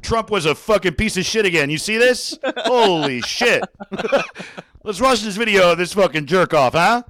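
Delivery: loud, hyped anchor ranting at the camera; news anchor turned hype man, all energy; Wolf Blitzer pumped up. Retold or imitated. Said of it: "If Wolf Blitzer was like".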